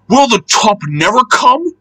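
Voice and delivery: high, plaintive engine voice; acted storybook exasperation; struggling. A narrator's reading. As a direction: strain upward as if the climb won't end.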